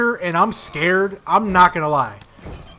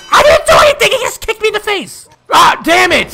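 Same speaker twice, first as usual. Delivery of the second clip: high, loud, outraged protest; indignant outburst; 10 semitones higher, 5 dB louder than usual. Real, not acted. Gamer yelling at the game after a kick to the face. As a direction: cry foul at the top of your voice.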